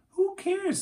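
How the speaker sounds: high, breezy who-cares toss; carefree dismissal. A narrator's reading. Annie dismissing Jack's worry about a license, carefree toss-off. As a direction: brush it off gleefully.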